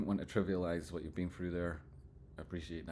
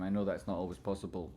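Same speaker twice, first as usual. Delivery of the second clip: normal talking voice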